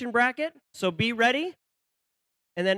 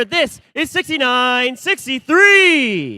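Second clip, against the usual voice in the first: arena announcer; 9 dB louder, much slower than usual. Acted. Announcing team numbers.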